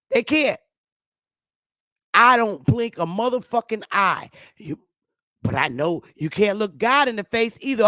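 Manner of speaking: usual speaking voice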